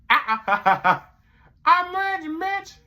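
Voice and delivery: very high, very slow put-on voice; goofy high voice. [name] telling his boss Mitch he's ready, very high and slow. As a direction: stretch it out in a silly high voice.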